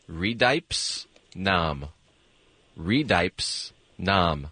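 slow, drawn-out reversed words; backwards speech. Shown as a demonstration. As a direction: spell it out backwards, slowly. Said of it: "the second word I'm saying backwards".

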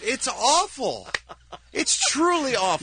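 high, slow, appalled verdict; disgusted. Real, not acted. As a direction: drag out the disgust.

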